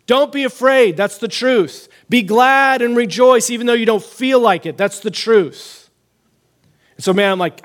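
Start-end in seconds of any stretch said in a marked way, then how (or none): 0.0-5.9 raised, emphatic declaration; proclaiming scripture. Retold or imitated. Preacher proclaiming truth against the lies, emphatic and higher. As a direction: declare it firmly, leaning on each key word. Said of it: "I started proclaiming the scripture".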